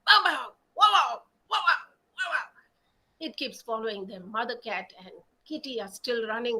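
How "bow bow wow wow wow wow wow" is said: loud, high shouted woof woof; dog barking. A narrator's reading. Dog chases the cats, loud high barking. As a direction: bark like an angry dog. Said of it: "The dog keeps following them".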